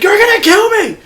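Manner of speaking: high, loud panicked yelp; terrified kid yelling at his would-be helpers; panicked cry. Retold or imitated. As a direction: cry out as if in mortal danger. Kid panics, falls out door.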